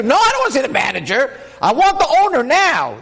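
very high, outraged insistence; hammed-up, high-pitched indignation; demanding customer. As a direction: play an irate shopper who won't be fobbed off. Acts out customer refusing the manager.

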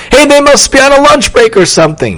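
high-pitched, eager realization; excited boy's thought. A narrator's reading. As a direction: think aloud, seizing the chance.

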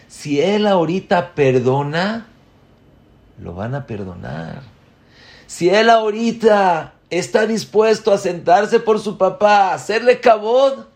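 high, loud preaching rise; fervent urging. Retold or imitated. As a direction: press the point with full force. Rabino aconseja con fervor. (exhortación fervorosa, aguda y fuerte)

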